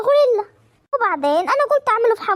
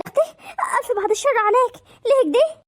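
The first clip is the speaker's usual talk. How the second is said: شهقة فزع; 6 semitones higher than usual. Acted. فزع مصطنع على الحماة.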